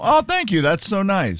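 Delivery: high-pitched, sweetly grateful gush; nice, gracious thanks. Shown as a demonstration. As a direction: accept a compliment graciously, pitched right up. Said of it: "see how nice that was".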